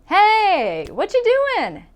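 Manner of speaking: high, bright surprised Hey what you doing; surprised greeting. Acted. Friend surprised to see you at the mall. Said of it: "the first person would say".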